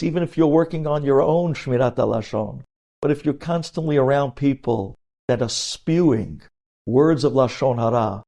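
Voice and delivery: usual speaking voice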